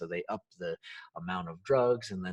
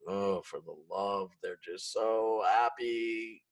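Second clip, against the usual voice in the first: judging others' happiness; slower than usual. Retold or imitated. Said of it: "we go".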